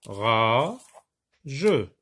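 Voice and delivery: each syllable spoken separately. Shown as a demonstration. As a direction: break it into GA, RA, JE with pauses. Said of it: "la méthode de base consiste".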